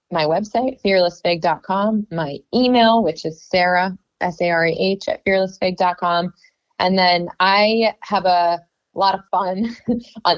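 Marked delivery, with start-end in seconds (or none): none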